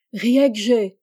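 calling from far off; distant call. Acted. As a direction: shout the name from far away. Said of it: "Une voix lointaine appelle Rieg-G". (appel lointain)

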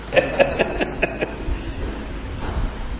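soft, spaced-out chuckles; soft laugh. Real, not acted. Teacher amused by his pupils, soft laugh. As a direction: laugh quietly in short pulses. Amused after asking if they understand.

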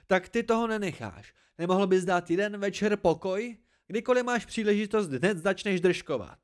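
high-pitched, exasperated scolding; exasperated outburst. A narrator's reading. Hespe fed up with Dedan's constant arguing, exasperated. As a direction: pile up the complaints in rising irritation.

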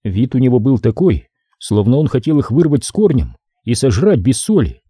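ordinary delivery, nothing marked

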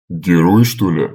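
mocking snort. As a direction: sneer at the offer. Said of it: "фыркнул Глыба".

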